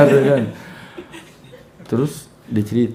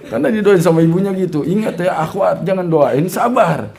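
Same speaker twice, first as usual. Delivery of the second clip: higher, louder teasing; jokey raised warning; playful warning; 6 semitones higher, 6 dB louder than usual. Real, not acted. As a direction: tease the sisters.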